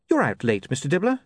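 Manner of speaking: mild, courteous lift on the name; polite. A narrator's reading. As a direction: speak up politely to an acquaintance. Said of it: "he said politely".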